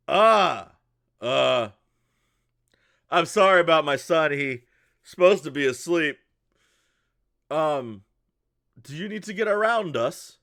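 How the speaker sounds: put-on just woken up, apologizing for his kid; apologetic sleepy father. Father apologizes to the traveler about his son, loud apologetic voice.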